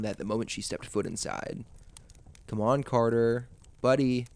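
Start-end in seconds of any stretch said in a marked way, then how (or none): none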